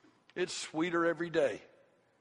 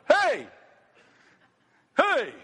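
put-on high, loud toddler holler; little girl's high-pitched yell; 9 semitones higher, 7 dB louder than usual. Father mimicking little Chloe coming into his room, high-pitched yell. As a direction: imitate a small child hollering hello. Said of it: "do this little high-pitched yell at each other".

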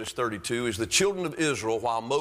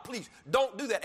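high-pitched acted plea; begging; 9 semitones higher than usual. Moses begging God to spare his people, pleading. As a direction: plead with God to hold back. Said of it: "He's begging God".

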